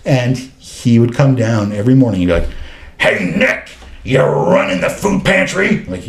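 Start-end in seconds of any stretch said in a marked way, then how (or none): 3.0-5.8 louder, higher rough mock greeting; imitating Mark's rough voice. Client Mark greeting Nick at the office door, rough imitation. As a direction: call it out like a regular's morning hello. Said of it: "he had this rough voice".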